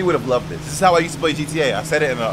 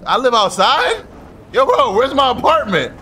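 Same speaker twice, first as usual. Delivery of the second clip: high, loud baffled protest; exasperated outburst; 7 semitones higher, 4 dB louder than usual. Real, not acted. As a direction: call out in loud disbelief. Cannot find his apartment.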